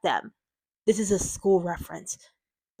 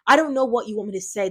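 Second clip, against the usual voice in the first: usual speaking voice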